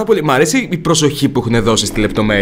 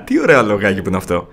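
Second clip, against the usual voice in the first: ordinary talk, nothing marked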